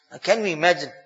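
normal talking voice

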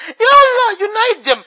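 high tearful cry, voice breaking; real weeping dua; crying plea. Real, not acted. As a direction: sob the plea to unite them. Pleading for families before Eid.

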